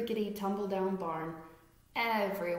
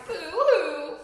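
loud, high boo-hoo wail; mock crying; 8 semitones higher, 10 dB louder than usual. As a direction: cry like a scared rat. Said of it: "who cried".

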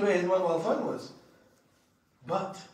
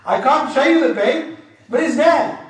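punchline said loud and high; emphatic repeat; 7 semitones higher, 11 dB louder than usual. Retold or imitated. Preacher repeating the punchline loudly to the crowd. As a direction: hammer the line home for the crowd.

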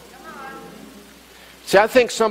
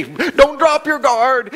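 much higher, slow, yelled warning; coach yell; 10 semitones higher, much slower than usual. Retold or imitated. Boxing coach yelling at the young fighter from the sideline. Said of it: "they'd yell from the sideline".

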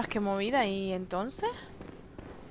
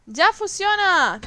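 excited; 7 semitones higher, 6 dB louder, much slower than usual. Real, not acted. Cheering game working again.

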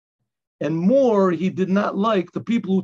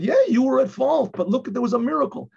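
retold excitement; 6 semitones higher than usual. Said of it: "I said".

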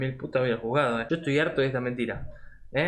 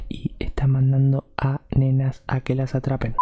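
an unvoiced conspiratorial whisper; a hushed secret whispered to chat; 4 dB louder than usual. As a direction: whisper the worst part so nobody hears. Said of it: "para que no nos escuchen".

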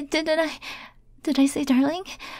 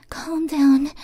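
voiced, firmer calm down; firm self-steadying. Panicking admirer orders themself to calm down, firm self-steadying. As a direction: get a grip on yourself.

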